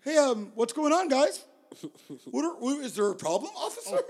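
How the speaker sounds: put-on a teen faking innocence to cops; mock innocent tourist. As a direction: play dumb with the police, all sugar.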